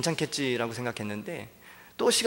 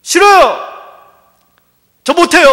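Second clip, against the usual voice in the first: much higher and louder protest shout; shouted refusal; 19 dB louder, slower than usual. Acted. Hosea refuses God's command, shouting in protest. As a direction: scream it, refusing outright.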